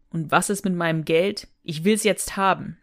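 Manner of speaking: ordinary talk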